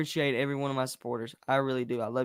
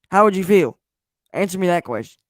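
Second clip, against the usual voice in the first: demanding; 5 semitones higher, 10 dB louder than usual. Real, not acted. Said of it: "demands an answer".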